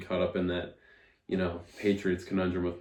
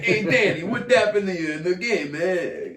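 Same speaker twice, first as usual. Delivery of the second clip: high, louder hammed-up New York guy; New York accent impression; 10 semitones higher, 6 dB louder than usual. Podcaster imitates New York fan yelling at Danny, hammed-up New York accent. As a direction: play a pushy New Yorker hollering at the quarterback. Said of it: "It was pretty good impression".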